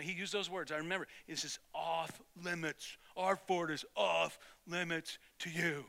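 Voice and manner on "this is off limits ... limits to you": slow, deliberate, softer bully's warning; grudging mock warning. Bully who gave in bars boys from his fort, grudgingly mad. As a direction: spell out each word as a quiet threat. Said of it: "He was still mad, and he gave in".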